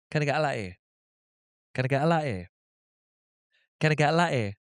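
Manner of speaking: put-on strong Geordie accent with the T's dropped. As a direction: order quickly in thick Geordie. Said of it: "she's got a really strong Geordie accent".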